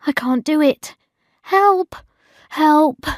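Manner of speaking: high mock-desperate cry for help; panicked. A narrator's reading. Bridget dreading work after a sleepless night, panicked.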